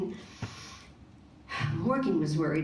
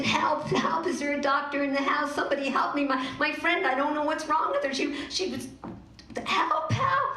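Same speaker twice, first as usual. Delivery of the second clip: high, louder, frantic calls for help; panicked cries; 7 semitones higher, 3 dB louder than usual. Acted. Morgan crying out for help over frozen Stacy, panicked. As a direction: shout for a doctor in panic. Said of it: "She panicked".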